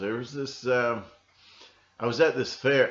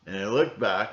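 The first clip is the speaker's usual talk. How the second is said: usual speaking voice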